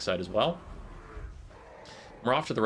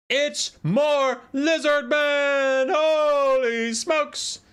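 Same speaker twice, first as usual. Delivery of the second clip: very high, loud, drawn-out hype yell; excited shouting; 5 dB louder, much slower than usual. Real, not acted. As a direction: shout the reveal, stretching every word. Caster hypes the army reveal.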